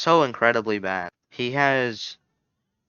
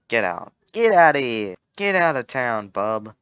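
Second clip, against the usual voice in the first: loud gruff tough-guy threat; 7 dB louder than usual. Pretend. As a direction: bark it like a bouncer throwing someone out. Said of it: "instead of that".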